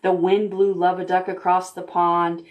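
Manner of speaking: plain reading voice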